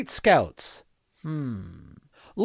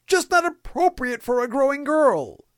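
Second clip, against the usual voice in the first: scandalised; 9 semitones higher than usual. Acted. Scandalised fatherly outrage.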